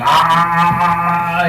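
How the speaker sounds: drawn-out open-mouthed "ahh"; imitated long "ah". Comic mimicking a man gawking at the stage, long "ahh". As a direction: hold a long, steady ahh. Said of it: "he sees me on stage going".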